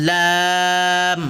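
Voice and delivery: লাম held long on a level tone; two-alif stretch. Shown as a demonstration. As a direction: draw out the "aa" of লাম for two counts. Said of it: "এগুলো দুই আলিফ করে".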